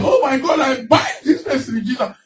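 high, indignant burst, half-laughing; angry outburst. Retold or imitated. Preacher retelling her angry dream, high indignant laugh. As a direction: rebuke the dream intruder, laughing at yourself. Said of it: "I was just angry".

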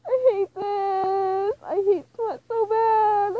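high, loud, drawn-out whine; hammed-up sobbing complaint; mock-crying wail. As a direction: wail it out very slowly in mock agony. Breaks off reading to lament.

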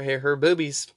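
usual speaking voice

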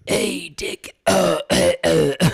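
rough smoker's rasp with coughing; hacking old detective voice; gravelly detective voice. Pretend. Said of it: "can we hear it".

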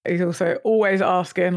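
ordinary talk

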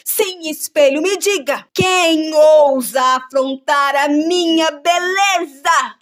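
loud, slow, high demanding queen; imperious queen. Acted. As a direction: drag out each word, wounded pride.

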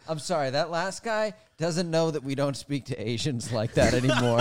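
smooth it over for the room, lightly; host's deadpan apology. Retold or imitated. Host resetting the room after a bad comic, a mock-earnest apology. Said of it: "He goes".

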